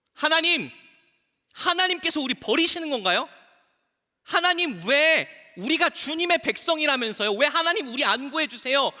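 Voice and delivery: high, insistent cry of reproach; anguished complaint. Acted. Israelites crying to God over being abandoned, reproachful. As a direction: plead upward, demanding to know why. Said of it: "하나님께 원망하기 시작해요".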